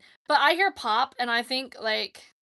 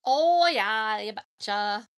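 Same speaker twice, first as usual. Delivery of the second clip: Minnesotan accent with a long, louder "Oh"; classic Minnesotan accent; 4 dB louder, much slower than usual. Shown as a demonstration. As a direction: do a stock Minnesotan accent, drawing out the opening. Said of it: "That's classic Minnesotan".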